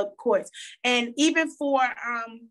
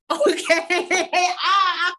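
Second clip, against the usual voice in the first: laughing; 7 semitones higher, 6 dB louder, much slower than usual. Real, not acted. Laughing at her own chills.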